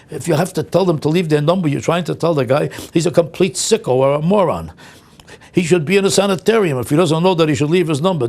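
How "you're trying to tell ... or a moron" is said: ordinary talk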